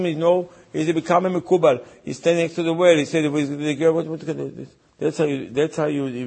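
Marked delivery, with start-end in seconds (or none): none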